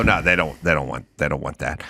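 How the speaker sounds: mock low-voiced denial; quoted denial. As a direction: imitate someone's low, quiet brush-off. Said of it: "And he'd go".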